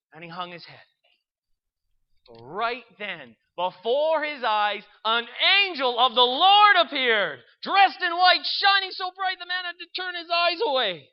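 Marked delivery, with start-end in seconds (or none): 3.6-11.1 loud, high, awestruck announcement; dramatic reveal. A narrator's reading. Angel appears before the praying man, dramatic loud reveal. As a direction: build to a loud, soaring proclamation.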